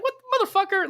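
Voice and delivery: high-pitched mock disbelief; incredulous outburst. Viewer recalls rewatching the cave scene, high incredulous outburst. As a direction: exclaim it high, as if baffled by what you saw.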